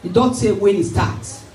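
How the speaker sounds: ordinary delivery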